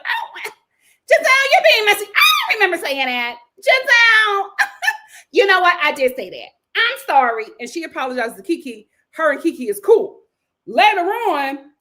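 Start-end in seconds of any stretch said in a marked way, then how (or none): 0.0-7.5 high, loud mock squeal that breaks into a laugh; mock outburst. Kiana caught out by Giselle, hammed-up high loud squeal. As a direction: ham up a caught-out woman getting loud, then laughing. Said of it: "And Kiana was just like".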